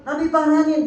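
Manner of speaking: ordinary talk, nothing marked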